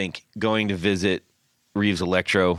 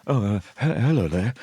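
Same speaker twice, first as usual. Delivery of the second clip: Hugh Grant. Acted. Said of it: "Hugh Grant's gonna go".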